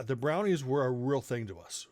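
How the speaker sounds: normal talking voice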